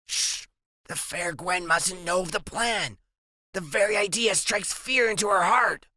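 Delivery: put-on hushed, grand secretive kid voice; conspiratorial boy. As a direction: whisper the secret plan importantly. Narrator voices Freak conspiring.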